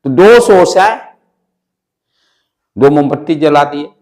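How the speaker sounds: ordinary talk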